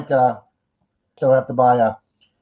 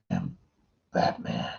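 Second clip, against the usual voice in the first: gravel voice, hushed and raspy; whispery growl of a masked hero; Batman; 12 dB quieter, slower than usual. Acted. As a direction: drop to a soft, gravelly rasp. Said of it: "You gotta have the gravel voice".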